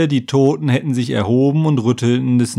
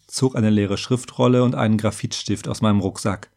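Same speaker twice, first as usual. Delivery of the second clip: usual speaking voice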